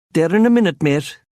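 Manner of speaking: high, bright County Cork lilt; high-pitched Cork accent. A narrator's reading. Narrator voices the barman's Cork greeting. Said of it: "in the high-pitched tones of County Cork".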